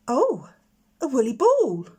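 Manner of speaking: loud, bright exclamation of delight; delighted cry. Acted. Little girl crying out when she sees the ball, delighted. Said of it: "cried the little girl".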